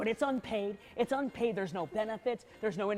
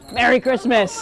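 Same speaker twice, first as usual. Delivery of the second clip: loud greeting; 10 dB louder than usual. Acted. Elf stuck, calling greeting.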